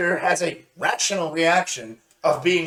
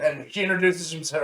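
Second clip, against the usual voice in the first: ordinary talk, nothing marked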